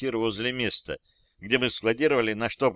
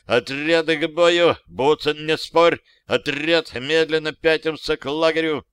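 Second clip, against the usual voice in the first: raised, higher commanding voice; battle command; 5 semitones higher, 5 dB louder than usual. A narrator's reading. Soldier ordering squad to fall back, raised command. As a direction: order the squad, louder and pitched up.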